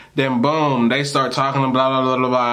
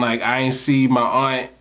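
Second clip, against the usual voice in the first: ordinary delivery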